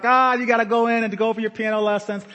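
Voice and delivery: put-on high teasing kid taunt; sing-song mock of the piano boys; mocking friends. Friends teasing the kids heading in for piano lessons, high taunting. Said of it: "Our friends made fun of us".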